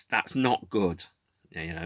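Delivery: normal talking voice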